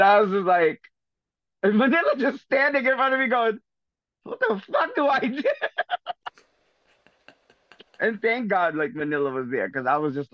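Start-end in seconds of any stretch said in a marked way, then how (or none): 4.2-5.4 laughing panic. Retold or imitated. Friend panicking, quoted laughing. 5.5-6.3 sudden high-pitched laugh bursting out; a real, high giggling shriek; high squealing laugh. Real, not acted. Laughing at her own story.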